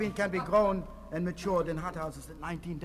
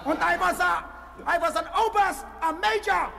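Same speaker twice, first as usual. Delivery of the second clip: ranting officer; 9 semitones higher than usual. Pretend. Fake Indian shouts his army career to the interviewer. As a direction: bark out your ranks proudly. Raving about his rank.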